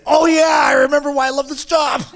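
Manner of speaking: loud, high burst of retold delight; elated exclamation. Shaman retells smoking the medicine, elated exclamation. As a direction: shout it like a joyful realization.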